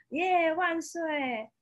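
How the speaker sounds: high, slow storybook hooray; excited cheer. Acted. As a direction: stretch out a big happy cheer. Kitten cheers going shopping.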